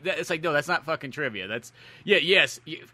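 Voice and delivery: normal talking voice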